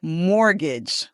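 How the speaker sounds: "Mortgage" as MOR-gij, T left out; teacher's model "Mortgage", stress up front; silent T. Shown as a demonstration. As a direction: say "Mortgage" carefully with no T. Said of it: "We don't want to hear that at all".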